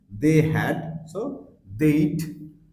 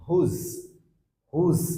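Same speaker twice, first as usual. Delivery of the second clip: ordinary delivery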